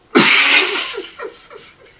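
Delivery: loud, high burst of laughter. Real, not acted. Laughs at own remark.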